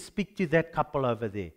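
low, soft inner voice; voice of the Holy Spirit. Retold or imitated. Holy Spirit quietly nudging the preacher, low and soft.

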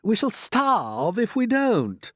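high, slow, loud retort; arguing centipede. Acted. Centipede counters the earthworm's objection, alarmed. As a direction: stretch the warning out.

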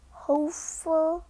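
ordinary delivery

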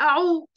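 عو said as throaty ain plus a rounded u; a teacher sounding out عو for the class; ain with damma. Shown as a demonstration. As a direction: model عو with rounded lips. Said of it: "باخد واحدة من الضمتين".

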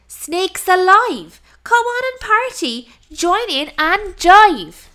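exuberant invitation. Acted. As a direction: sing it out with glee. Monkey cheering snake to party.

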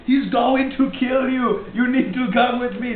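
Arnold Schwarzenegger. Acted. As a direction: urge them along like a ponderous action hero. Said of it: "Arnold Schwarzenegger pops in".

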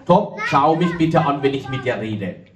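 normal talking voice

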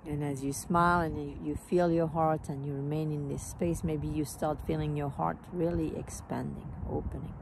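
calm meditation voice, phrases spaced by long pauses, softening as it goes; guided meditation. Real, not acted. Teacher leading a smiling heart meditation, calm and unhurried. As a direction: guide them gently into the heart, letting phrases fade.

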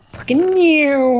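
high, slow, strange put-on voice; high silly voice. Put-on voice at friend.

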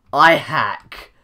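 I hack yelled loudly, falling at the end; mock shouted confession. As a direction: shout I hack as a joke boast.

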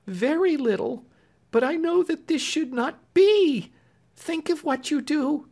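high, pleading maid's voice; tearful Clemency. A narrator's reading. Clemency weeping and pleading with Marian, high voice. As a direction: beg her through tears. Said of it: "cried Clemency".

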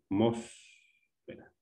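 "atmósfera" slowly, MÓS held long and set apart; stressed syllable. Shown as a demonstration. Teacher pointing out the tónica, stressed syllable. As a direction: hold the MÓS of "atmósfera", then a gap. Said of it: "las que llevan mayor fuerza de voz".

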